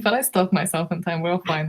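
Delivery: normal talking voice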